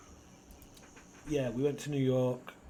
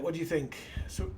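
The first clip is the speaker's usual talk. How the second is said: usual speaking voice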